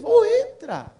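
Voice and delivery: high-pitched welcoming call; imitated call from the doorway. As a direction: call them inside, voice lifted high. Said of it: "Ela chamou eles".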